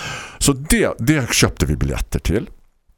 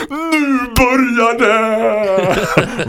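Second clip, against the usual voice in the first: long, drawn-out high shriek; loud, stretched, high-pitched mock child yell; excited child's scream; 13 semitones higher, 5 dB louder, much slower than usual. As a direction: imitate a small child screaming it out slowly. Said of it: "så skriker hon".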